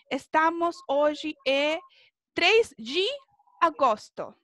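slow Portuguese model, "de" said "dji"; lone DE as "dji". Shown as a demonstration. Teacher making up an example sentence, "de" pronounced "dji". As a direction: say "hoy" as OH-zhi and "de" as "dji". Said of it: "también se pronuncia G".

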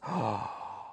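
sharp surprised intake of breath; amazed gasp. Acted. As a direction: gasp at the sight. Amazed at jungle animals.

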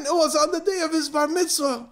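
high-pitched theatrical grief; hysterical wail. Acted. Crying women answering father, high mock wail. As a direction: cry out the awful detail. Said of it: "two favorite women in the world crying".

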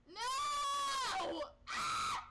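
high yell then gasp; startled scream. Real, not acted. Gamer caught by a jump scare, high yell. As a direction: scream in fright.

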